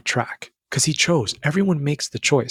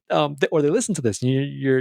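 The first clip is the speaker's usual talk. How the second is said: ordinary talk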